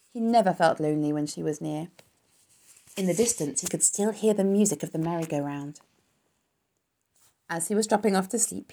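ordinary delivery, nothing marked

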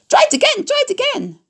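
very high, sing-song goose call; honking goose cry. A narrator's reading. Goose urging Wilbur to dodge, high honking cry. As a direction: squawk the orders like a flustered goose. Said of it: "cried the goose".

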